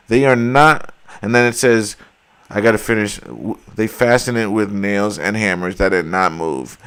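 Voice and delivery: usual speaking voice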